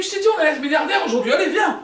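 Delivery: fast, high-pitched urging; excited boasting. Acted. Newly rich husband fetching his wife, excited urging. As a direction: rush it out, rising to a high call.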